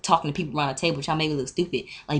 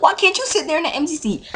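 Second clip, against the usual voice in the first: very high, incredulous retort; indignant comeback; 13 semitones higher, 3 dB louder than usual. Retold or imitated. Student turning the question back on seat-stealer, indignant. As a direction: throw the question back in a squeaky mock-outraged pitch.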